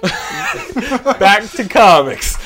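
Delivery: slow, laughing announcement; host laughing his way back to topic. Real, not acted. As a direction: drag it out through a laugh.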